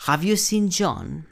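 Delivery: "Have you seen John" slow, in a high questioning tune; question intonation. Shown as a demonstration. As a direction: model "Have you seen John" for students to copy, slowly. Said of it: "debes ponerle cierta entonación".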